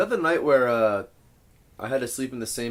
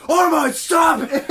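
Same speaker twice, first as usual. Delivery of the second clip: high, loud, drawn-out yell; exasperated shout; 7 dB louder, slower than usual. Retold or imitated. Matt yells at the girls to quit, exasperated shout. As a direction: shout them down at the end of patience. Roommate snaps at the repeated calls.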